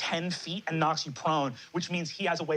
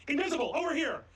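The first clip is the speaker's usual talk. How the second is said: high, urgent callout; alerting call; 8 semitones higher than usual. Acted. Enemy points out the invisible creature to allies, urgent high call. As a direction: call out a discovery, pitched up. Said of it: "And he goes".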